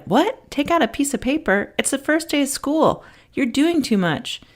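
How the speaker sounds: a student scoffing at the teacher; sassy student. Retold or imitated. Student pushing back on teacher.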